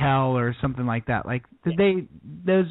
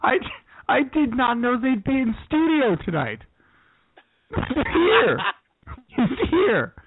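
high, bright mock welcome; excited radio host; 7 semitones higher than usual. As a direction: greet a surprise studio guest. Mock greets surprise studio guest.

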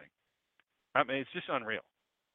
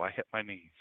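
usual speaking voice

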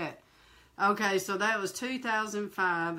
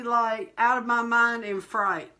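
ordinary talk, nothing marked

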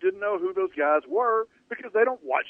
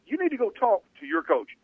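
you need to go talk to your coach, with the first you raised and leaned on; stress on you; 6 semitones higher than usual. Retold or imitated. A father telling his sons to sort it out, stress on you. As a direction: hit the first you and let the rest fall. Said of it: "Emphasis on the word you".

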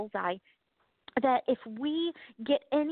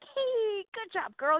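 cheery praise; 8 semitones higher, much slower than usual. Retold or imitated. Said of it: "God's like".

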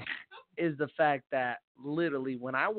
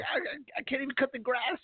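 high-pitched, stammered mock complaint; mock exasperation; 11 semitones higher than usual. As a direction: play someone overwhelmed by the heat. Acted complaint about the heat.

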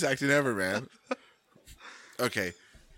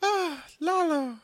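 swooning damsel; slower than usual. Pretend. Acting damsel love scene.

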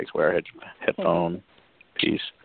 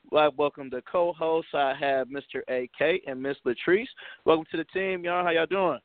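higher, upbeat show-host voice; podcast host intro; 8 semitones higher, slower than usual. Acted. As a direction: kick off the episode like a host. Podcast host opening the episode.

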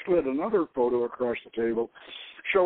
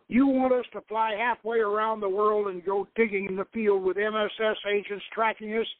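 raised, heated refusal; angry and pitched up, throwing the job back; growled; 8 semitones higher than usual. A narrator's reading. Roland quitting on Victor, growling and raised. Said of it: "Roland growled as he turned to leave".

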